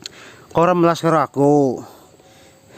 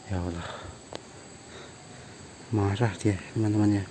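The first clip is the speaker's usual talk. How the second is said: low, much softer sigh to the camera; hushed aside; 7 semitones lower, 14 dB quieter than usual. Real, not acted. To viewers, about the angry man: hushed sigh. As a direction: breathe it out so he can't hear.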